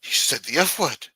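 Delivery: mock posh English accent, scandalised. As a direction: play an appalled English gent clutching his pearls. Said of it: "They scoff at that shit, you know? Like".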